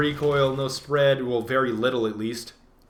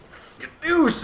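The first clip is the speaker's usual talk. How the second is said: high exclaimed catchphrase; triumphant cartoon kung fu cry; mock kung fu yell; 13 semitones higher than usual. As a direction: sell the finishing blow like a cartoon hero. Kung Fu Panda catchphrase finisher.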